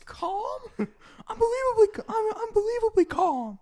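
very high, loud indignant squeal; indignant Connie. Retold or imitated. Connie protesting to the detective, shrill indignation. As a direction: insist you are calm while shrieking. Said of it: "Connie's like".

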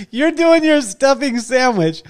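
amused, half laughing it out. Real, not acted. Host laughing at the story.

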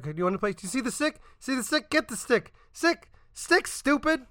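mocking Nedry taunting dog. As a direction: tease it like a dog with a stick. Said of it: "he's like".

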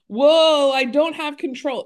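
a loud, high, hammed-up cry of alarm; startled shout of fake panic; mock panic. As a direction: play the driver who suddenly can't steer. Acting out driver losing car control.

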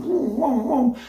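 high, playful whooshing car noises; a mock swoosh of rivals overtaking; imitated whoosh of cars speeding past. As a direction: zoom past like fast cars on a motorway. Cars racing past in fast lane.

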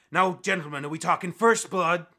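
loud captain, dropping at the end; captain addressing fighters. Acted. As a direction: put the choice like a referee. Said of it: "he looks at you guys and goes".

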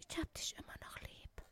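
breathy whispered love line. Retold or imitated. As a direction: whisper it like a fragile crooner. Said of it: "in der deutschen Musik".

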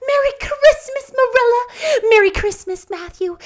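very high, loud sing-out greeting; joyous calling out. A narrator's reading. Anne greets the household on Christmas morning, exuberant. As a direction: ring it out, exuberant girl. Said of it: "singing until her voice re-echoed".

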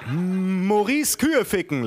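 high, drawn-out teasing call; Danilo's teasing. Acted. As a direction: tease a friend at the top of your register. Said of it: "lachte Danilo".

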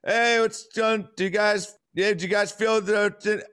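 high, slow, stumbling acted greeting; failed attempt at communicating. Tripping friend tries greeting strangers who came round the corner, fumbling. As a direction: act a halting high-pitched try at small talk. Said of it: "a failed attempt at communicating".

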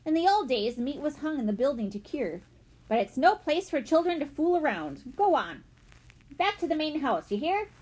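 gruff. A narrator's reading. Tate sending children back to the house, gruff and stern. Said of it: "Tate said somewhat gruffly".

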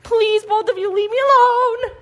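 high, drawn-out plea to pets; exasperated pleading. Real, not acted. Begging two pestering dogs.

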